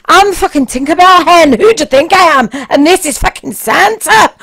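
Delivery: put-on loud, high and broad Scottish accent throughout; a brassy Glaswegian woman telling the cop off, Scottish accent; thick Scottish accent. Tinkerbell mocking the policeman's question, loud Scottish accent.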